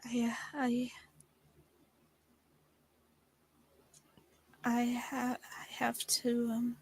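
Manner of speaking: hushed, halting false starts; tongue-tied and soft, stretching out each attempt; nervous stammer. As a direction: struggle to get a confession out, nearly whispering. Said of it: "I got so nervous being around you".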